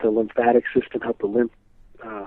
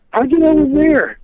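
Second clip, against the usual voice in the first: pitched way up, stunned and amazed; amazed clerk; 14 semitones higher, 4 dB louder than usual. Retold or imitated. Clerk on the phone, stunned at the caller, amazed high question. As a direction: ask it like you can't believe it. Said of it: "And the guy laughs says".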